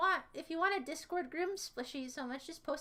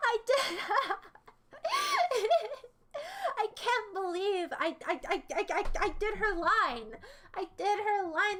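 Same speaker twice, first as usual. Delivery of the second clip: higher and louder, giddy and breathless with joy; excited; 5 semitones higher, 3 dB louder than usual. Real, not acted. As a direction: still riding the thrill of success. Still celebrating the line.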